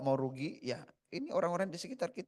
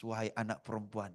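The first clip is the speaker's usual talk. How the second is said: ordinary talk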